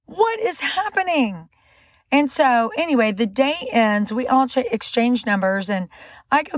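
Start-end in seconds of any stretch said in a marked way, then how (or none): 0.0-1.5 reenacted freak-out, pitched up; mock freaking out. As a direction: stretch it out in bewildered alarm. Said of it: "I'm freaking out".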